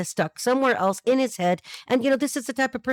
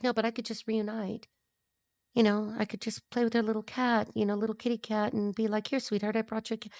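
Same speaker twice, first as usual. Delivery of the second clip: hushed, sweet-talking mock lover; soft coaxing; 6 dB quieter than usual. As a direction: drop to a soft, wheedling murmur. Voicing a wheedling would-be reuniting ex.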